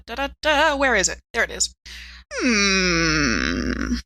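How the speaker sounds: ordinary talk, nothing marked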